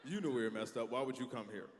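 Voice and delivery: smug business voice. Retold or imitated. Rude business scolding its customer, low and dismissive. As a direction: sneer at the customer from below. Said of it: "they look at you like".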